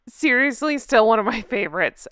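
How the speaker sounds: normal talking voice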